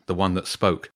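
usual speaking voice

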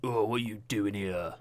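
put-on chavvy Londoner; chavvy London accent. Shown as a demonstration. Podcaster demonstrating a grotty local accent, mock chavvy Londoner. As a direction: have a rough go at a London chav. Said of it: "I guess a chavvy Londoner works".

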